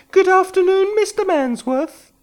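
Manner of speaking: high voice of a nervous rich woman; woman's voice. A narrator's reading. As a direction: play the client walking into the office. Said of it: "she said".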